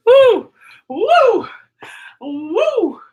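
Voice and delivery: high, loud cheer; loud woo. Real, not acted. Teacher whooping that school is over, loud cheer. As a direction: whoop it out in celebration.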